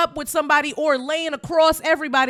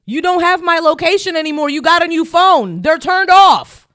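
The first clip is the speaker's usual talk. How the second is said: higher, raised-voice accusation; exasperated complaint; 5 semitones higher, 5 dB louder than usual. Real, not acted. Wife confronting husband about location sharing, exasperated.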